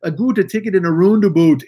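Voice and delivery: hammed-up Canadian "roundabout" vowels; Canadian accent. Mocking a friend's roundabout story, Canadian accent. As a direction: repeat it in a mock Canadian accent. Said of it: "that's me doing a Canadian accent".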